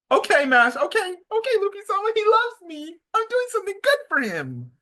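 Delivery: very high, giddy fangirl squeal-talk; swooning falsetto, tripping over the first words; mock lovestruck Seraphim. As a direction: imitate an adoring girl eager to obey. Acting lovestruck Seraphim obeying Luffy.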